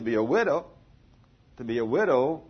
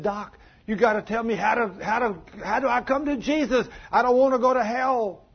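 panicked high plea, growing louder; retold screaming; 9 semitones higher, 5 dB louder than usual. Revived man begs his doctor to save him from hell, acted panicked screaming. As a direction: beg frantically, pitched way up. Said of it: "came back screaming".

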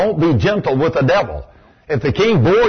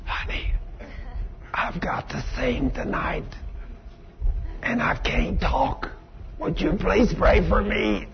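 hoarse whisper with no voice; barely audible, voice gone; 10 dB quieter, slower than usual. Retold or imitated. Wife who lost her voice whispering to husband. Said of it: "She comes up to me whispering like that".

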